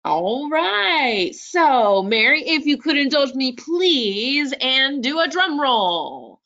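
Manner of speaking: high, swooping radio announcer. Pretend. Host asks co-presenter for a drum roll, high radio voice. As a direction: build suspense like a radio game-show host. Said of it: "use my radio voice here".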